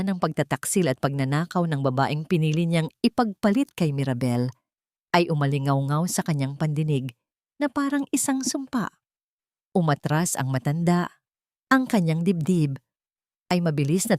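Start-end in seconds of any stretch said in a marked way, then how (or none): none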